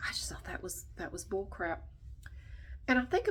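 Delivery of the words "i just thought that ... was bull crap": soft voiceless whisper, half to herself. Real, not acted. Summing up the holiday rule for viewers, quiet whisper. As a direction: hush it like a secret.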